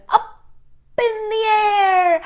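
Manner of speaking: high, slow, loud lift; soaring exclamation. A narrator's reading. Narrator following the kite up, high loud and slow. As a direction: stretch it up like the kite.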